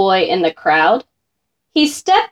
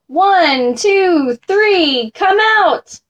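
high-pitched count, climbing to the call; crowd yelling; 7 semitones higher, slower than usual. A narrator's reading. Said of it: "The crowd yelled".

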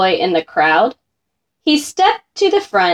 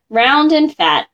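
ordinary delivery, nothing marked

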